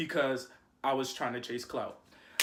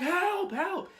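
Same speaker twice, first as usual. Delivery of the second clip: mock cry for help. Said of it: "And I'm screaming".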